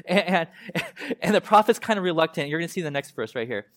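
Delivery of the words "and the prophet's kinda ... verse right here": ordinary talk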